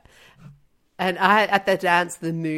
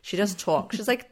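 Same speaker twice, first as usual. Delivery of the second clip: normal talking voice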